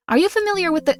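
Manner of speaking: usual speaking voice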